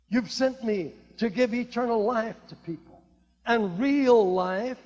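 usual speaking voice

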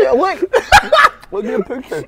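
high, laughing through the words. Real, not acted. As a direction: giggle while pointing it out. Laughing at the name.

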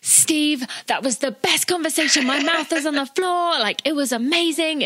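high-pitched, amazed gush; excited gushing. Retold or imitated. Podcast host raving to Steve after a great interview, excited gushing.

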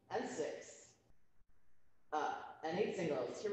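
soft, steady rep-count cadence; counting workout reps. Real, not acted. Instructor counting exercise reps.

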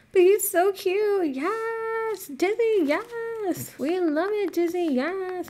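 very high cooing voice. Real, not acted. To Dizzy the villager, very high cooing baby talk. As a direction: coo over a cute pet.

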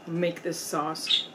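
ordinary delivery

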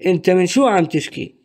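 أنت من شو عم تشكي run together quickly; fast native speed. Shown as a demonstration. Teacher models the full sentence fast for learners. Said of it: "What is the meaning of this full sentence".

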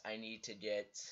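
normal talking voice

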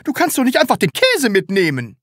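high, loud outraged shout. A narrator's reading. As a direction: yell it in outrage. Salesman scolding.

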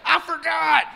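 high, loud dumb reply. Retold or imitated. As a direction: answer like a dimwit. Melvin answers.

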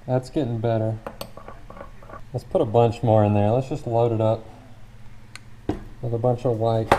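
talking through a face mask; muffled voice behind a mask. Real, not acted. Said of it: "sorry for the muffled tones because I've gotta wear this, this mask".

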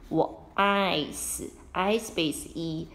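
slow, spelled-out sounds; teacher spelling out a vowel pattern. Shown as a demonstration. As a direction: say each letter slowly. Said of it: "发长音的爱".

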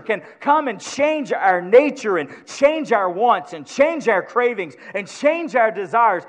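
loud, high, rising sermon cry; full-force preaching. Acted. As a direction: preach it out to the whole church.

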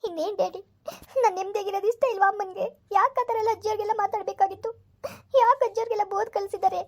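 tearful, breaking voice through sobs; pleading through tears to her father; crying. Acted. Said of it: "ಯಾಕೆ ಅಳ್ತಾ ಇದೀಯಾ?". (ಅಳುತ್ತಾ, ಗದ್ಗದ ಧ್ವನಿಯಲ್ಲಿ)

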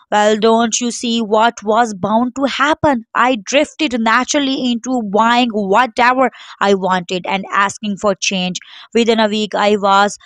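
plain reading voice

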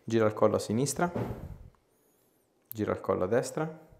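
ordinary talk

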